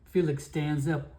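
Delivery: normal talking voice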